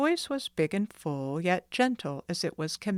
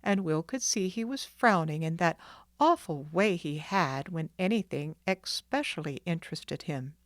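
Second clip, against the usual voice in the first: usual speaking voice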